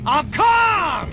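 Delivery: yelled high and loud; angry yell of I'm calm; shouting. Acted. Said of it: "Calm down".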